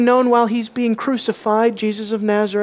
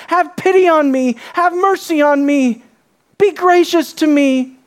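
raised, louder entreaty; higher, forceful begging; insistent plea; 6 semitones higher, 4 dB louder than usual. Acted. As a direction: call out each appeal to the king. Acts beggar's appeal from Psalms.

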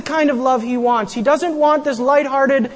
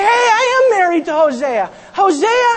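a wife shouting down her husband's rival; defiant retort; 7 semitones higher than usual. Acted. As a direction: stake her claim and push him back. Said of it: "she could have stood up and said".

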